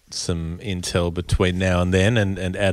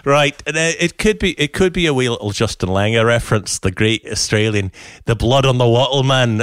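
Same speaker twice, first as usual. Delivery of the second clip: put-on Scottish accent, playfully grumbling. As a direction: perform it as a lively Scot on request. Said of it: "tackle this in your Scottish accent".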